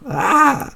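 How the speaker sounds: high, loud scream; sudden scream. Acted. Cry amid the barking chaos, high scream.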